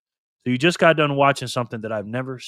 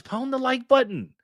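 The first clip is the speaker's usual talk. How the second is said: reading Paul's chat line; 12 semitones higher, slower than usual. Retold or imitated. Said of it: "Paul says".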